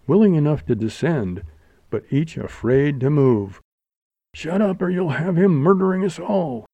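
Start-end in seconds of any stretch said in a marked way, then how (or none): 4.3-6.7 high, urgent warning; alarmed bystander, pitch raised; cautious warning. A narrator's reading. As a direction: plead with her to be silent. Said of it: "advised a cautious voice".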